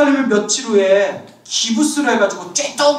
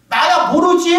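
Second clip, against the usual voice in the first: loud, higher blurted retort; gruff punchline; 7 semitones higher, 4 dB louder than usual. Retold or imitated. The head monk shrugs off his disciple, loudly. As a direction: toss it out loudly for the laugh.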